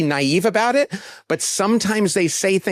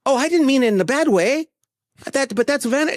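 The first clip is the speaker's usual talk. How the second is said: defensive friend; 9 semitones higher than usual. Retold or imitated. Friend's defensive reply, imitated.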